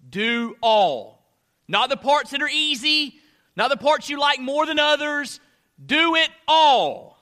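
loud, high preacher's exhortation; emphatic preaching. Real, not acted. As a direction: drive each phrase home forcefully. Preaches at full force.

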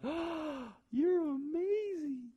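high, drawn-out swooning wife impression; hammed-up breathless adoration, imitating a smitten wife; adoring wife. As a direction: gush in a slow, very high feminine voice. Imagined wife gushing over flowers.

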